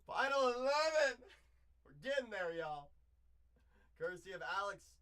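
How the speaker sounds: loud hype announcement; excited shout. Real, not acted. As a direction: cheer it out loud. Celebrating progress.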